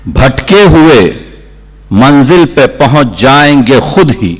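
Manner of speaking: declaimed Urdu couplet, measured with strong pauses; poetry recitation. Acted. Poet presenting a couplet to a mushaira audience, declaiming. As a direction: recite the verse out to a full hall.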